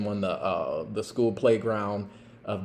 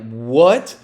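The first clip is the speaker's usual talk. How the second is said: shocked; 2 semitones higher, 10 dB louder than usual. Retold or imitated. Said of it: "And she's like".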